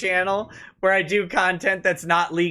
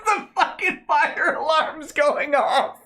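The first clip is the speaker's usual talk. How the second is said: laughing; 9 semitones higher, slower than usual. Real, not acted. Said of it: "laughing at the clip".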